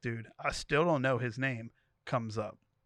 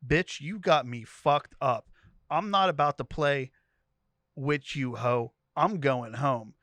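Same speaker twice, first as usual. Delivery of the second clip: going off; 5 dB louder than usual. Retold or imitated. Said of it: "I start going off".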